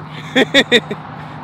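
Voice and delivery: a burst of loud, high laughter. Real, not acted. Angler laughing at his own day, loud laugh. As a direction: laugh out loud before answering.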